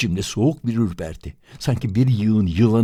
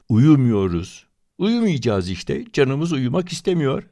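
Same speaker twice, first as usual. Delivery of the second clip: insisting; 6 semitones higher than usual. Acted. Boy insists louder.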